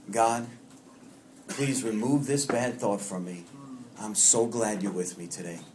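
a gentle, unhurried prayer spoken slowly. Acted. Repeats his simple prayer.

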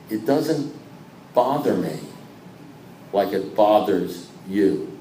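soft, slow and low, unbothered; calm steady sponsor voice, slowed right down. Retold or imitated. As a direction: let it land quietly like a wise old sponsor.

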